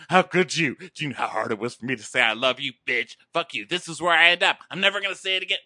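hammed-up wounded rant; play-acted jilted outrage; mock hurt anger. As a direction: lash out in loud, uneven bursts. Acting the rejected man lashing out.

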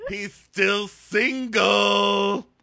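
very slow, high, loud coy hint; exaggerated matchmaking mom; mock mom hint. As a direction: drag out the hint like a pushy mother. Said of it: "And then she goes".

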